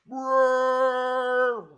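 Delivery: loud, high imitation of a roaring engine. Telling friends how the car tore the corner, a loud imitated engine roar. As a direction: belt out the car's roar. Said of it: "And the car just went".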